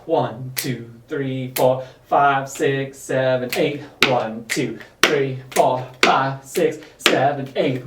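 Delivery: low, steady counted beat; counting dance beats. Shown as a demonstration. Counting beats, lower register.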